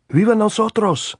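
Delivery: very high, loud cheer; shouted. A narrator's reading. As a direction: shout it back in kind. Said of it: "Andres shouted".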